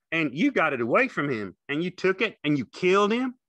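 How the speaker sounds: high, loud questioning; Carlson, demanding. A narrator's reading. Carlson standing over George, loud demanding question.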